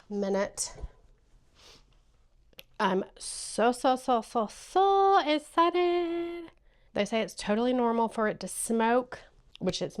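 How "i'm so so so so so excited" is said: excitement building to a high, loud, drawn-out peak; excited. Real, not acted. Crafter bursting to start her first sublimation, excited. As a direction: stretch the last words out, louder and higher. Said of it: "I'm excited".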